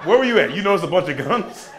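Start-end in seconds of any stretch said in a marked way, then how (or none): 0.1-0.5 incredulous. Real, not acted.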